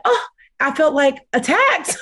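high-pitched, laughing admission; amused exclamation. Real, not acted. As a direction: blurt it out and break into a laugh. Laughs recalling feeling attacked.

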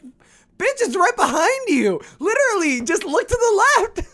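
high exasperated retort, laughing. Retold or imitated. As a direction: snap back in disbelief, laughing. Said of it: "I'm like".

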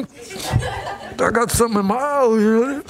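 slow, laughing through it; mock excuse. Pastor mocking himself at a sad movie, laughing. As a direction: pretend it's just dust. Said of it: "I'm over there going".

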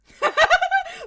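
high, loud shrieking laugh; real high-pitched cackle of laughter; loud burst of laughter. Real, not acted. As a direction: crack up hard at the joke.

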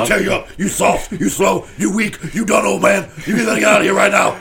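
wrestling promo. Pretend. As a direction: taunt an old rival. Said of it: "cut a promo".

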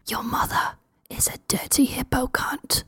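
soft, menacing stage whisper; whispered insult. Acted. Player whispers an insult in a hippo man's ear, whispering. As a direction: whisper it right into the enemy's ear. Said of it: "thing that you whisper in his ear".

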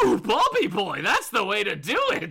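loud taunting villain; gleeful Joker. Acted. Joker goading Bob at gunpoint, gleeful Joker. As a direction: egg him on with mock delight. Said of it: "And the Joker goes".

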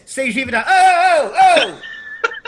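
high, loud exasperated oh-ing; demoing how New Yorkers say it, hammed up; New York style. Shown as a demonstration. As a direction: shout it the way a JFK worker would. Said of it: "They say it like this".

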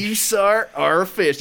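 exaggerated copy of another man's catchphrase; John Marston. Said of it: "he says it differently".

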